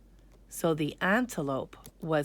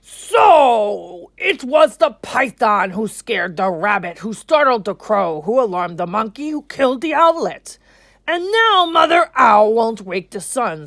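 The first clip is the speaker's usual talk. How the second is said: louder, booming lion king; king's voice; 4 semitones higher, 9 dB louder than usual. Acted. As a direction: announce it like a ruler. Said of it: "The king said to the council".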